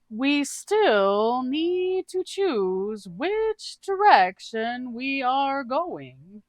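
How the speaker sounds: high, slow, drawn-out quirky voice; odd character voice. Acted. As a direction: stretch each word out in a high, cheerful oddball voice. Doog's voice again.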